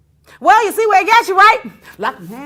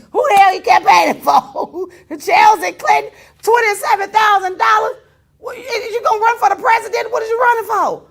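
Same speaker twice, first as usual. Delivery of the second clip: loud, high, incredulous shouting; retold yelling; 9 semitones higher, 9 dB louder than usual. Retelling how he yelled at George over the fee, loud and incredulous. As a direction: yell it in disbelief at a friend.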